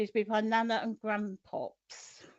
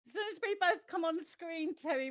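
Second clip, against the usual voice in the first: ordinary talk, nothing marked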